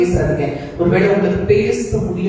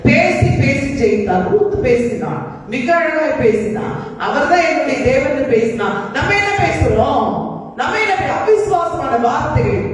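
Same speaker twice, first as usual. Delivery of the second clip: high-pitched, ringing sermon declaration; impassioned preaching; 7 semitones higher than usual. Real, not acted. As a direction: proclaim it to the congregation, voice pitched high. Preacher builds in fervour.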